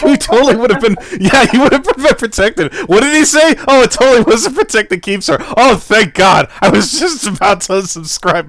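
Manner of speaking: high, loud laughing through words; cracking up mid-sentence. Real, not acted. Laughing while teasing over the clip.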